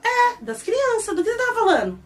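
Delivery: high, stretched questioning; acted incredulous question; puzzled retort. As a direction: toss it back in high-pitched bafflement. Acted puzzled reply, covering confusion.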